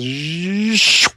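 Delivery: imitated whoosh. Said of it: "then it just goes".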